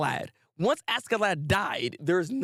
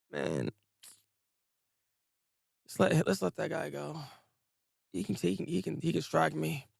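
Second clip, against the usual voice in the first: resigned murmur; 5 dB quieter than usual. Retold or imitated. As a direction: give up inside, barely voiced. Thorfinn voiced, resigned and numb.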